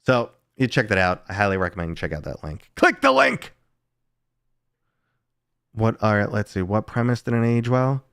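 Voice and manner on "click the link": high, squeaky little order; squeaky command. Acted. Streamer urges chat to click, in a squeaky voice. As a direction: squeak it out like a cartoon. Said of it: "urging viewers to click".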